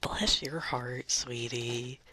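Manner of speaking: hammed-up Southern sweetness; mock Southern belle. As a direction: play a syrupy Southern lady, stretching every word.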